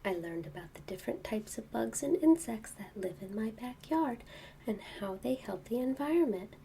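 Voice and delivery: soft quiet storybook reading; bedtime reading. Acted. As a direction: read softly to a child in bed. Reading book to child.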